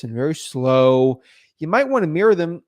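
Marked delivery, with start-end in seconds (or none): 0.0-1.2 slow talking seller. Shown as a demonstration. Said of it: "they're just talking like this".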